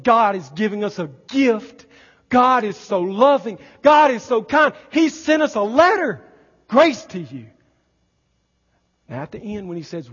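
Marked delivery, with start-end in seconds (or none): 0.0-7.5 loud, high, rising preacher's cry; full-force pulpit exclamation; fervent preaching. Acted. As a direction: preach it with mounting awe. Preaching builds to a climax.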